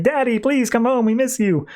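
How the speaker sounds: high-pitched mock child's plea; pleading kids' cry. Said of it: "are screaming like".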